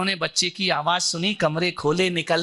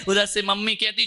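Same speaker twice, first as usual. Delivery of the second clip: ordinary delivery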